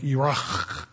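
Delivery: Iraq as "ee-RAH" with a long throaty ending; Saddam Hussein. Retold or imitated. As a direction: imitate a native Iraq, rasping the final q. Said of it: "heard Saddam Hussein pronounce the name".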